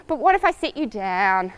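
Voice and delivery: ordinary talk, nothing marked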